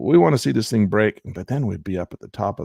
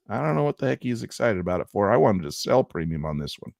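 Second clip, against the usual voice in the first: usual speaking voice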